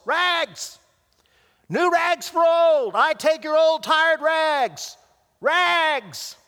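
high, loud street-vendor cry, drawn out slow; clear tenor call. A narrator's reading. Rag man calling through city alleys, loud and high. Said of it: "calling out in a clear tenor voice".

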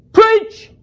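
high, loud call of "Preach!"; shout. Retold or imitated. Old man yelling up at the preacher, high shouted call. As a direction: call up from the pews. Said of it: "he shouted".

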